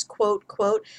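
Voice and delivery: high mocking air quotes. Shown as a demonstration. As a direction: flick the pitch up sharply on quote quote. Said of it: "air quotes voice for orphan".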